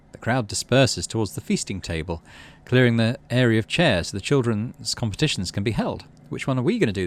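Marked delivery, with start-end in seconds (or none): none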